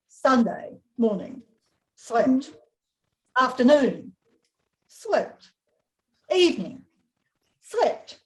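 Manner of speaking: deadpan diary read with long pauses; reading to children. A narrator's reading. Author reading her wombat book aloud to a class, dry and deliberate.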